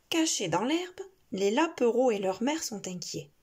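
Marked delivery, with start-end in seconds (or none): none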